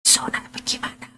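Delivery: quick unvoiced whisper, barely audible. Shown as a demonstration. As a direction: murmur it so the kids won't catch it. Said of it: "So, dia bisik".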